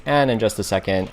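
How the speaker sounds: usual speaking voice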